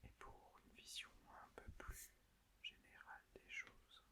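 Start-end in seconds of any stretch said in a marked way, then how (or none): none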